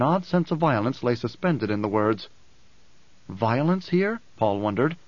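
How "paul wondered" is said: ordinary delivery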